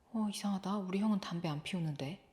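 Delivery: soft, puzzled thinking aloud; puzzled musing. Acted. Younger brother thinking as he trails his brother, quiet and puzzled. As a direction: murmur it to yourself, finding it odd. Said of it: "이상하게 생각하면서".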